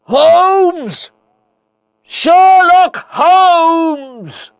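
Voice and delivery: very high, drawn-out calling; desperate loud shout. A narrator's reading. Watson calling into the falls for his friend, shouting as loud as he can. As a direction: shout the name across a chasm, holding each word. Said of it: "shouted as loudly as I could".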